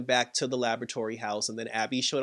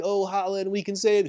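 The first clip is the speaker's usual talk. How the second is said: higher-pitched retold appeal; lightly voiced pleading, pitched up; survivor's plea; 6 semitones higher than usual. Survivor appeals to the creature, higher and pleading.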